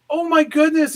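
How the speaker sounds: high, delighted Tom Cruise surprise; excited gasp. Retold or imitated. Tom Cruise thrilled to hear Dave at the gate, high excited. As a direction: gush like a star thrilled to see an old friend.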